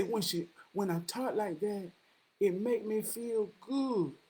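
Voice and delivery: slow, soft retold admission; quiet confession. Woman admitting that talking mean feels good, quietly. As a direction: draw it out softly, word by word. Her confession repeated, slowly.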